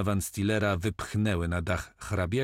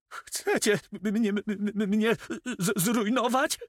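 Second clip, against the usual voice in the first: much higher, stuttering in panic; squeaky stammer; 13 semitones higher than usual. A narrator's reading. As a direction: squeal out the words like a terrified miser. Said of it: "zapiszczał notariusz". (piskliwe, przerażone jąkanie notariusza)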